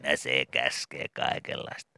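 dismissive nurse. Acted. Nurse answering child dismissively.

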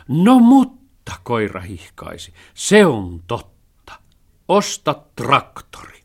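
the dog cheers the idea; delighted dog. A narrator's reading. (innoissaan hihkaiseva koira)

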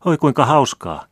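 high, loud, delighted exclamation; a lively child bursting with joy; excited child's cry. A narrator's reading. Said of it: "huudahti vilkas lapsi".

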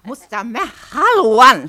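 loud, slow, stubborn; slow firm "but I want to"; firm insistence. Acted. Insisting over patient.

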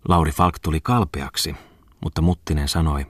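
ordinary delivery, nothing marked

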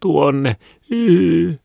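very high, tearful whine; crying child. A narrator's reading. Small boy pointing where the bread went, crying. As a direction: wail the answer with a long high sob. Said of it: "kovemmin itkemään".

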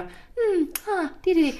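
very high, agreeable phone noises. Retold or imitated. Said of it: "hon har P R-rösten".

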